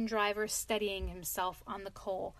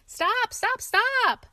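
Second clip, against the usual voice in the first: high, loud, urgent cries of stop; shouting stop; 13 semitones higher, 10 dB louder, much slower than usual. A narrator's reading. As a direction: bellow it like a distant posse. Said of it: "all shouting the same thing".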